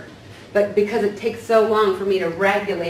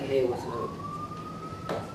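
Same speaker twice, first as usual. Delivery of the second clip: much higher, softer, very slow offhand reply; flat brush-off; 9 dB quieter, much slower than usual. Retold or imitated. Coworker answering a greeting with dismissive slow mumble. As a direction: give a lazy, uninterested hello back. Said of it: "and you're like".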